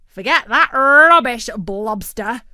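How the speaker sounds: loud, dismissive bird; loud scoff. A narrator's reading. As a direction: brush it off loudly. Turkey scoffs.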